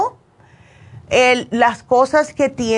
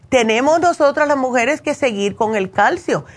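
ordinary delivery

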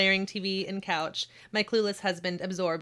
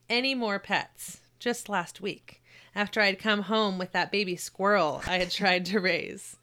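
ordinary delivery

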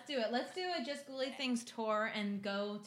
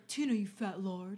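quoting Napoleon Dynamite; 3 semitones lower than usual. Retold or imitated. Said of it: "quote from Napoleon Dynamite".

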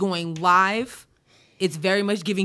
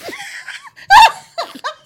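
loud high laugh; 13 dB louder than usual. Real, not acted. Laughing fit.

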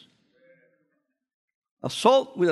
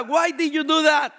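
mock parent question; 6 semitones higher, slower than usual. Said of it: "uno le pregunta".

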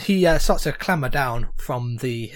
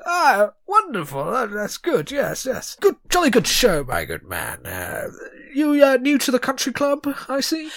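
pompous sputtering colonel; 9 semitones higher, 3 dB quieter than usual. Acted. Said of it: "he nods to that and goes".

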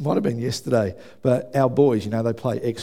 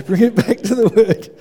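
louder, higher firm call to himself; preacher scolding himself back to the sermon; self-correcting command; 8 semitones higher, 8 dB louder than usual. Real, not acted. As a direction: bark it at yourself to get back on track.

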